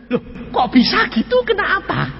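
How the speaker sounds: hammed-up high, breaking disbelief; astonished. Stunned husband asking his wife why, astonished. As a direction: squeak it out in mock amazement. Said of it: "suami sampai melotot kaget".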